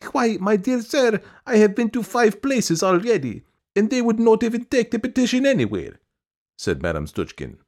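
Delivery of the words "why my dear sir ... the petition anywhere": very high, protesting woman; piping, aggrieved supplicant; insistent petitioner. A narrator's reading.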